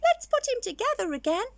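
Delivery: bright little skeleton. Acted. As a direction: cheerfully suggest fixing him. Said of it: "said the little skeleton".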